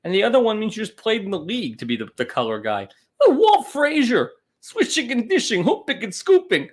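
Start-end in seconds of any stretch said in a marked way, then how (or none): 3.2-6.7 loud, high-pitched sportscaster impression; mock basketball broadcast, pitched up and punchy; Walt Frazier. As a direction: call it like an excitable color commentator. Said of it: "being the play-by-play".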